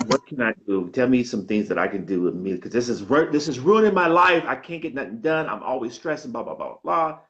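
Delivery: a listener venting that stress is ruining their life. Acted. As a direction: play a frustrated listener pleading for help. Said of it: "They're hearing, like".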